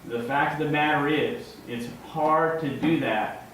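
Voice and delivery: normal talking voice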